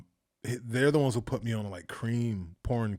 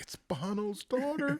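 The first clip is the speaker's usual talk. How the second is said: awed hype; slower than usual. Retold or imitated. People hyping the celebrity kid.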